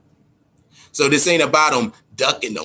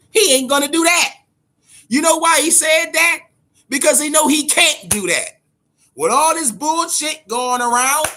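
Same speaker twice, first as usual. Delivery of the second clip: heated rant; 12 semitones higher than usual. Real, not acted. Scene: ranting to viewers that Thurman won't fly, high heated. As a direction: rant hard and high about the boxer.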